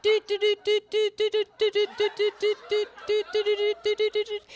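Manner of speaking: mock Morse code beeping; Morse beeps. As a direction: tap out Morse code with your voice. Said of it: "So he's suddenly like".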